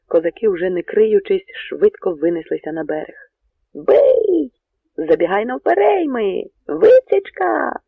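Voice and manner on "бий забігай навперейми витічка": high, loud battle yells; clamouring shouts. A narrator's reading. Poles raise the alarm as Cossacks break out, shouting high. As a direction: yell orders with stretched word endings. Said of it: "галасували поляки".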